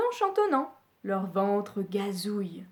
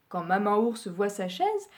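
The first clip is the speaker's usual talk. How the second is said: ordinary delivery, nothing marked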